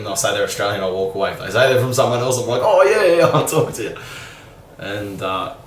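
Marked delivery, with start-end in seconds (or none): 2.6-4.0 high, loud, laughing show of interest; mock eagerness. Imitating himself meeting foreign girls, suddenly all eager. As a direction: jump in high and keen, then laugh through it.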